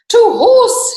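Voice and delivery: high, loud heroic call; rally the troops with a shout; cried out. Acted. Custard rallies his friends, loud heroic cry. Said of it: "he cried".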